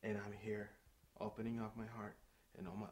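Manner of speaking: ordinary delivery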